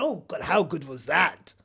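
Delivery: ordinary delivery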